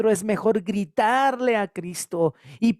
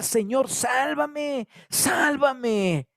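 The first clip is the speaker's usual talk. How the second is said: Peter's cry for help; 6 semitones higher, much slower than usual. A narrator's reading. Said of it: "empieza a gritar".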